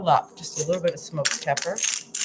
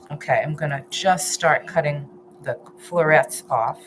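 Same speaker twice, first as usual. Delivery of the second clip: ordinary talk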